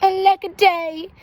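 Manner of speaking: hammed-up storybook sorrow; mock lament. A narrator's reading. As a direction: wail like a forlorn little dinosaur. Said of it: "And he cried".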